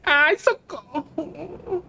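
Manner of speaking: slow soft whimpered plea; scared plea. Real, not acted. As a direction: whimper for help. Lost in a horror game.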